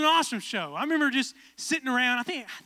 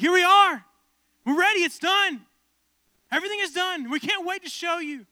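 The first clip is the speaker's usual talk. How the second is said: high, loud triumphant host; Ty Pennington impression; 7 semitones higher, 7 dB louder than usual. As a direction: announce it like a hyped host. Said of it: "Ty's like".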